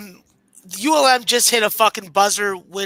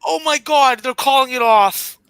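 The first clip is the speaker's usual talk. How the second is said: shocked live call; 4 semitones higher, 5 dB louder than usual. Real, not acted. Live review reaction.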